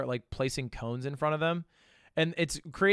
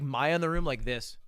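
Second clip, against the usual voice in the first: ordinary talk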